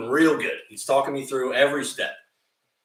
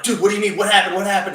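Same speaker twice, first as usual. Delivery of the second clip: frantic questions; 7 semitones higher, 6 dB louder, much faster than usual. Retold or imitated. Panicked questions to friend.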